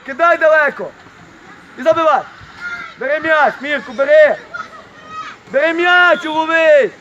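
loud, urgent sideline shout; shouted instructions. Real, not acted. Shouting to young players to throw and take the ball, loud calls. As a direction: call out loudly across the pitch.

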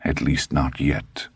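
usual speaking voice

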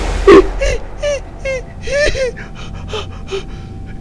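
shrill panicked giggle; high frightened laugh; high nervous laugh. Real, not acted.

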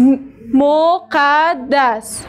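مقدس very slowly with doubled d; slow repeat. Shown as a demonstration. Teacher repeating مقدس slowly for the class.

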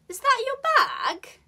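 high, loud, BAG dips and lifts; very high start, swoop on "bag"; high-pitched polite swoop through "bag"; polite fall-rise question. Shown as a demonstration. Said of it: "this intonation is supposed to make questions sound".

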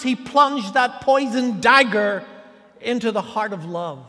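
loud, rising pulpit delivery, each line hammered; full-force preaching. Acted. Pastor preaching Peter's failure to the congregation, loud and rising. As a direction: preach it out hard over the congregation.

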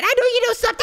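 high, loud accusing squeal; accusing yell. Acted. Nico accuses Mia of hiding something, high accusing yell. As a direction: burst out at a suspected liar.

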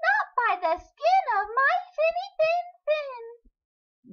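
very high, loud little fish; smart little fish. A narrator's reading. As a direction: squeal it back cleverly. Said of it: "the smart fish replied".

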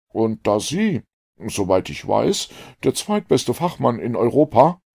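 put-on a keen, relieved young man; a lightly voiced eager doctor. A narrator's reading.

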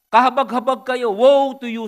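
preacher thunders Jesus' woe at the Pharisees; thundering woe. Acted. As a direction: cry the woe out like a prophet. Reading Jesus' woes aloud.

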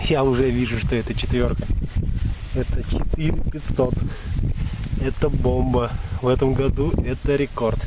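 thrilled, loud over a record fish; real joy at the catch; excited. Real, not acted. Поймал рекордного сазана. (радостно и взволнованно)

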